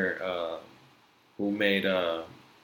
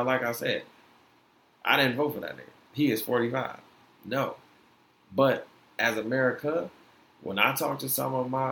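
usual speaking voice

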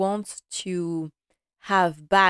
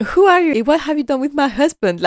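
loud, high, incredulous mock shock; mock disbelief; 8 semitones higher, 9 dB louder than usual. Wife teasing her husband about his sudden change, loud and incredulous. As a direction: blurt it out like she can't recognise him. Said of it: "she's like".

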